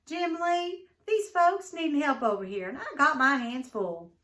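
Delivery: high, loud call across the store; a wife calling loudly for her husband. A narrator's reading. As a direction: call him over from across the shop. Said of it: "his wife called".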